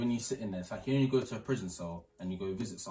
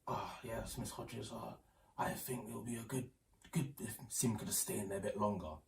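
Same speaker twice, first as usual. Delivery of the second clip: much softer than usual, voicing dad; hushed phone-call imitation; 10 dB quieter than usual. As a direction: quietly act out dad's sneaky call. Acting out dad's secret phone call.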